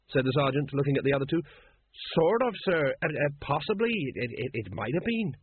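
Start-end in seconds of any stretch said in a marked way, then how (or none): 2.2-5.4 halting, unsure reply; hesitant. A narrator's reading. Sergeant evasively answering his captain, halting and unsure. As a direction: stumble through a doubtful answer.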